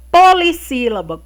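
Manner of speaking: ordinary talk, nothing marked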